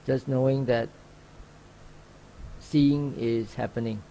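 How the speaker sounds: calm, even meditation guide with long pauses; unhurried instructions, leaving long silences between phrases; guided meditation. Real, not acted. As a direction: gently guide sitters into open awareness. Leads a sitting meditation.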